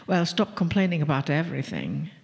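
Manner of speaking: normal talking voice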